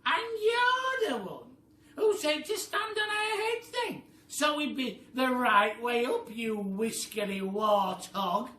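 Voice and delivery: a wife screaming insults back; a shrieking, slow, furious scream; angry scream. Acted. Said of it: "screamed Missus Twit".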